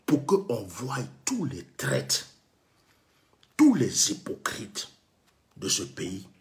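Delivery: slow emphatic. Real, not acted. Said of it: "slow emphatic declaration".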